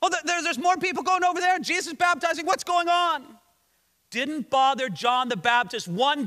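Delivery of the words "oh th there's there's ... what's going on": high, rushed mock panic; higher-pitched, hurried alarmed complaint; upset. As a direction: play flustered disciples in a tizzy. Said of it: "His disciples were all upset".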